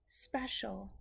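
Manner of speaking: whispered word. Acted. Teacher builds intrigue for the class.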